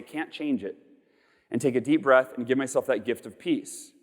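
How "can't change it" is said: quiet acceptance. Retold or imitated. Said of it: "I would say".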